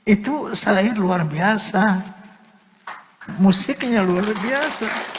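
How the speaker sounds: high, slow, overcome praise; drawn-out, emotional wonder; tearful awe. Real, not acted. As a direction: marvel at it close to tears. Overcome by own song's beauty.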